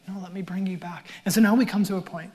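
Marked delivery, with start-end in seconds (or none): 0.1-1.1 soft whispered voice of God; hushed, gentle whisper. Retold or imitated. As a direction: breathe it like a tender plea.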